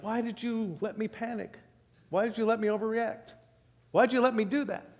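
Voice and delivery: a hint of mock whining; whiny regret. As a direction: whine about your own overreaction. Diner regretting needless panic.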